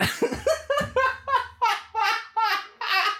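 high wheezing laugh; real laughing fit. Real, not acted. Fan laughing at his impression, helpless laughter. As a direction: crack up at your own act.

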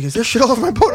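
high, laughing mock outrage; outraged boat owner. Boat owner asking about his boat, mock outraged. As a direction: play the owner aghast. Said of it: "He's like".

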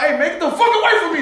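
high-pitched, quick mock outburst; acted mad. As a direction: act mad, pitch jumping up, words rushed. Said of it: "When you get mad".

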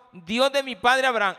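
preacher crying out Jacob's prayer to the congregation; loud prayer. Acted. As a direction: call out to God from the pulpit. Jacob's prayer voiced aloud.

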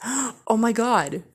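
sharp gasp, then loud, high, slow dismay; horrified gasp. Retold or imitated. Friend realizing she knows the caller, acted horrified gasp. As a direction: gasp and draw out the shock, acted.

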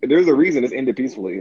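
ordinary talk

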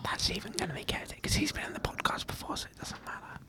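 hushed whispered test; ASMR whisper. Pretend. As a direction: whisper close to the mic. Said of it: "let's do some A S M R".

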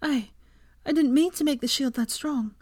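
high, hesitant, shaken voice; shakily. A narrator's reading. Hermione apologises to Ginny for her shield's strength, shakily. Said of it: "she said shakily".

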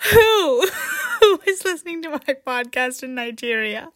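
high question through helpless laughter; laughing disbelief. Real, not acted. Podcaster amazed by a Nigerian listener, laughing incredulously. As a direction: crack up while asking.

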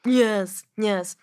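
normal talking voice

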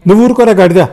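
high, loud snap; cross rebuke. A narrator's reading. Sister silencing her teasing brother, cross. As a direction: shut him up sharply.

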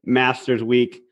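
ordinary talk

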